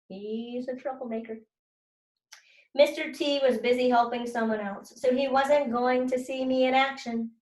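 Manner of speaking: ordinary talk, nothing marked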